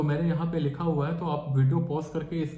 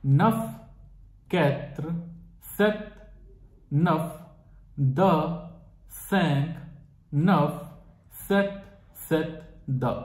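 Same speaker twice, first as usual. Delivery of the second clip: digits said slowly one by one with long pauses; teacher repeating phone number in French; slow dictation; much slower than usual. Shown as a demonstration. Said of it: "I repeat once again".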